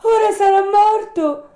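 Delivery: very high, loud distressed interruption; anguished high-pitched outburst; great sorrow. Acted. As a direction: cut in with a pained puppet cry. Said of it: "interruppe Pinocchio con gran dolore".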